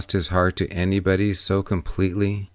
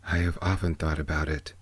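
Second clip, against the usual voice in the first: a touch of sadness, softer and quicker; subdued, regretful confession; sadly, softer; 5 semitones lower, 6 dB quieter, faster than usual. A narrator's reading. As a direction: admit it quietly, with a hint of sorrow. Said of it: "said Siddhartha sadly".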